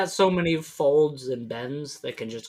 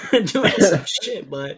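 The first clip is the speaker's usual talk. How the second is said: laughing; 5 semitones higher, 4 dB louder than usual. Real, not acted. Giggling over a silly pun.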